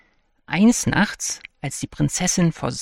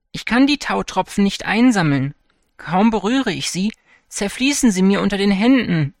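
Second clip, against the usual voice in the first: higher, louder mild lament; 5 semitones higher, 4 dB louder than usual. A narrator's reading. Princess laments to the old man, higher and louder. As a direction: complain helplessly. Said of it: "klagte die Prinzessin".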